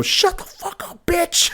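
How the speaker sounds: high, drawn-out catty snarl; jealous girlfriend. Retold or imitated. Girlfriend snapping at the cheering cousin, high and catty. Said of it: "just go".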